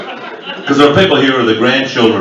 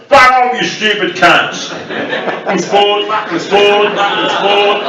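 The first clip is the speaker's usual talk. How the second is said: broad Aussie demo; 8 semitones higher, slower than usual. Shown as a demonstration. Comic demonstrating the Aussie initiation line, exaggerated broad Australian. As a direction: ham up the Aussie yob for the crowd. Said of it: "Every Australian has to be able to say".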